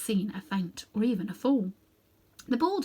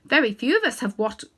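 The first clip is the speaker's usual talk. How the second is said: haughty elephant lady, much higher and louder; proud she-elephant; 5 semitones higher, 6 dB louder than usual. Acted. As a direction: speak like a dignified elephant pleased with her trunk. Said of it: "with a pardonable pride".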